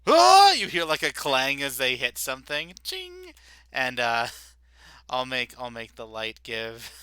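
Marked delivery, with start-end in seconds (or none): 0.1-0.5 high, loud sudden cry; sharp yell. Acted. GM crying out as the clang rings. GM yells a sound. 2.8-3.3 high metallic ching; clang sound effect. Acted. GM making the clang of a weapon strike.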